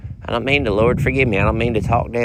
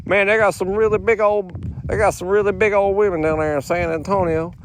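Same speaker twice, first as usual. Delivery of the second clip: lightly voiced Barkley, higher; Charles Barkley impression; 6 semitones higher than usual. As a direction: loose Barkley imitation. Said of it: "as Charles Barkley says".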